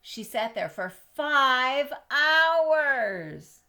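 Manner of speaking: very slow, loud and high, stretching every word; drawn-out emphasis. Acted. Reading to a child, stretching out how long the cat sat. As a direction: lean hard on the long wait. Reader stresses the long wait.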